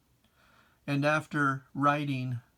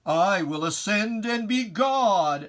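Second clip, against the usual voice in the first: loud, higher, boastful proclamation; proud Lucifer; 9 semitones higher, 10 dB louder than usual. Acted. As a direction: declaim it as an arrogant rebel angel. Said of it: "This was the attitude of your proud heart".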